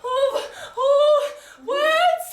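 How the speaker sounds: high, loud hammed-up cry; mock anguished wail. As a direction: wail it like a melodramatic heroine. Heroine's anguished outcry.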